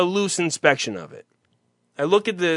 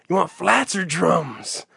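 mimicking the wing guy; 4 dB quieter, slower than usual. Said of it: "the guy who's asking me if I want flats".

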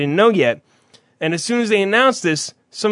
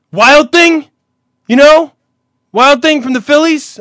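loud, high, incredulous prompting; imitated exasperated explaining; 8 semitones higher, 9 dB louder, slower than usual. Guy in line explaining a ballplayer, loud imitated exasperation. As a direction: punch it out as if it's obvious.

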